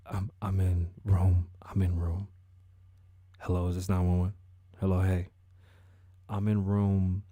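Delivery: low, halting undertone; 911 caller. Acted. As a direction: play a caller keeping it low on the phone. Acting a hushed 911 caller.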